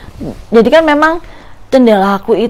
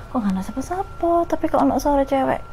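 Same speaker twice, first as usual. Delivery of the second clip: hushed; 4 semitones higher, 9 dB quieter than usual. Retold or imitated. Peeking out at night, scared.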